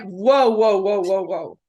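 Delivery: imitating a startled spirit holding back; mock whoa. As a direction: pull him up short with a mock whoa. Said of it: "He's like".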